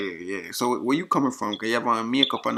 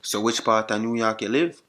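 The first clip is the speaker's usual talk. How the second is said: thick Jamaican patois; put-on Jamaican accent; 6 dB louder than usual. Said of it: "used my Jamaican accent".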